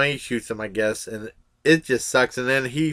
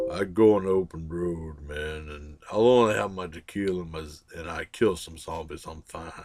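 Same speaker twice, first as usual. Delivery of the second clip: imitating the driver; 7 semitones lower, 6 dB quieter than usual. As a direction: drop your voice low and lazy like the guy behind the wheel. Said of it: "he talks like this".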